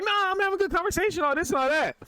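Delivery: high, quick mock protest; imitated protesting woman. Imitates drunk girl refusing her friend.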